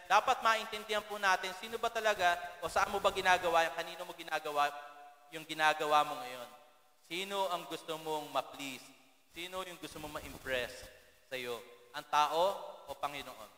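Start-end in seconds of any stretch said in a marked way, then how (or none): none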